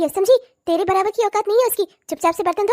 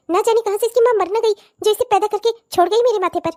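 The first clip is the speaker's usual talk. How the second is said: normal talking voice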